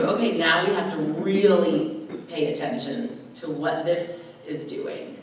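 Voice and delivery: ordinary talk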